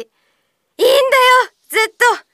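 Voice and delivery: high, loud burst of encouragement; excited encouragement. Real, not acted. Host cheering a listener's casual speech, excited. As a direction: cheer them on, delighted.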